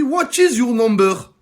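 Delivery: put-on English accent. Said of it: "elle me dit".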